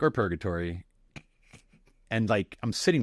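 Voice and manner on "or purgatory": offhand, casual interjection; nonchalant. Retold or imitated. Student contradicting the chaplain in class, nonchalant. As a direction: drop it in coolly, unbothered. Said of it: "in my nonchalant way".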